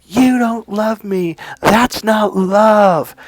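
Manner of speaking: high, slow, drawn-out reproach; imitating angry girlfriend. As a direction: reenact a wounded, pissed-off rejection. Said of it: "She was pissed".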